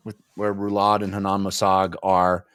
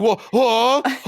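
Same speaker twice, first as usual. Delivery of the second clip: high, loud, confused double take; high yelp of confusion; startled emperor; 13 semitones higher, 5 dB louder than usual. Retold or imitated. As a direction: play a baffled, startled young emperor. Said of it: "And Rulad's like".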